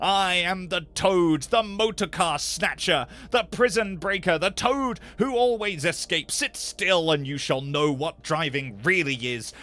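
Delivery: loud, bragging roar, slowed at times; boastful reckless shout. A narrator's reading. Toad speeding the car, shouting his boast at the passengers. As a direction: crow over them at the wheel, loud and wild. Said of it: "he shouted recklessly".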